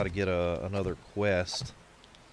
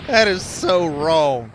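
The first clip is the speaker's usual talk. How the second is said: slow, loud, higher "that is so wrong"; mock outrage; 7 semitones higher, 12 dB louder, slower than usual. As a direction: ham up the disgust. Mock outrage at chat joke.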